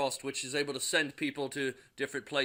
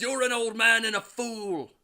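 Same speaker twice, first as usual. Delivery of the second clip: higher, louder defiant yell; angry shout; 7 semitones higher, 6 dB louder than usual. Acted. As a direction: hurl the insult at your father. Thor shouts back at Odin.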